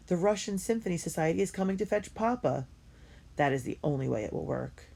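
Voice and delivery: ordinary delivery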